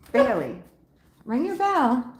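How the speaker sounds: high sing-song voice to the cat; pet talk. Real, not acted. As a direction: coax the cat up high and sweet. Talking to her cat.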